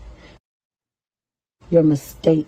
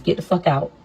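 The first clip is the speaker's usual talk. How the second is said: normal talking voice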